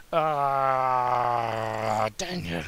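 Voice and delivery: mild mock growl. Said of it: "he growled".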